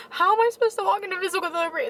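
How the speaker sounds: high, fast, emotional wail; worked up and close to crying; tearful. Real, not acted. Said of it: "Have you been crying?".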